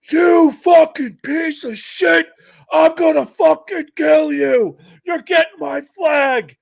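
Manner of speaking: put-on high, slow raging scream at the kid; furious shout. Old master chief screaming at the sailor who lost the flag, enraged.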